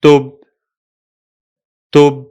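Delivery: "tub" as one closed syllable ending on b; syllable drill; syllable demo. Shown as a demonstration. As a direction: model "tub" slowly, twice. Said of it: "the second syllable is".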